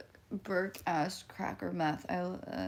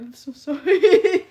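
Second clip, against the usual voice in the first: loud slow apology through laughter; laughing apology; 7 semitones higher, 18 dB louder, slower than usual. Real, not acted. Apologizing to Michael while laughing loudly.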